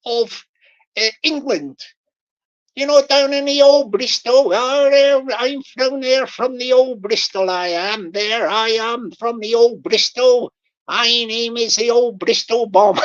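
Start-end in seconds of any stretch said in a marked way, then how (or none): 2.7-13.0 loud, hammed-up West Country voice, bragging about the old Bristol; playing a proud Bristolian, tongue in cheek; mock Bristol accent. Streamer mocking the scammer as a loud Bristolian.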